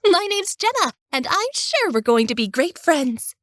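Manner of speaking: put-on high, bubbly teenage girl voice; cheery girl. A narrator's reading. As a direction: chirp it like a lively teenage girl. Jenna introduces herself.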